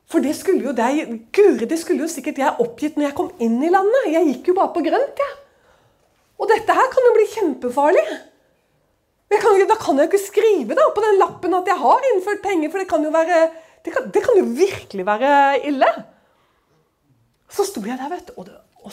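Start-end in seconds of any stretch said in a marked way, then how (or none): 6.4-12.7 retold panic. Panicking at customs form.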